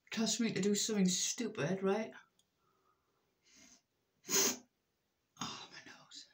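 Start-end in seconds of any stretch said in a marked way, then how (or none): 4.3-6.3 breathy startled whisper. Real, not acted. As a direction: whisper it in dismay. Sudden whisper mid routine.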